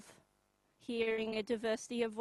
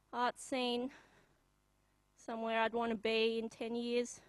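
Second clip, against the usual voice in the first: usual speaking voice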